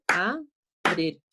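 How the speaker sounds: "abrir" split into a, brir; syllable by syllable. Shown as a demonstration. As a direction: say "abrir" in two separate beats. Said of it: "separo silábicamente".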